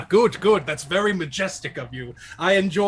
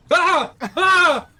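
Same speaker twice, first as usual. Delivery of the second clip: panicked screams; 11 semitones higher, 6 dB louder, slower than usual. Acted. Jackalope panics at talking demon.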